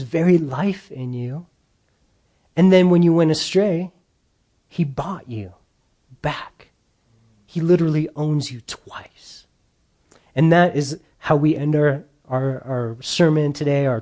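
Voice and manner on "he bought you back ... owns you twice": hushed, breathy whisper for emphasis. Real, not acted. Preacher telling the congregation God owns them twice, whispered. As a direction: drop to a whisper to make it land.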